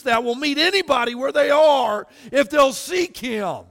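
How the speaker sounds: high, loud, impassioned sermon; full-force preaching. Real, not acted. Preacher urging doubters to come and ask, impassioned.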